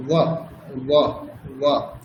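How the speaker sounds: touch teeth to lip for ওয়া. Shown as a demonstration. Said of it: "ওপরের দাঁত এবং নিচের ঠোঁট".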